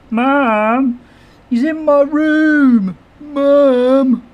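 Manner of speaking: put-on high whiny kid tattling to mom; whiny tattling little sibling. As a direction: whine like a younger sibling telling on the other. Kids arguing, streamer imitates.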